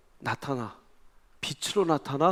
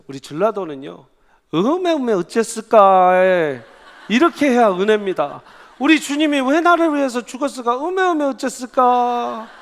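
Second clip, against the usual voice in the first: Jeolla dialect; 6 semitones higher, 7 dB louder than usual. Retold or imitated. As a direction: imitate a Jeolla pastor, stretching 음에 음에. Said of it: "제가 사투리를 못 써요. 그냥 해보는 거예요". (전라도 사투리 흉내, 음에 음에 늘여서)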